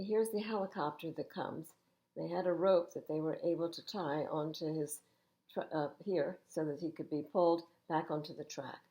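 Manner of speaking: ordinary delivery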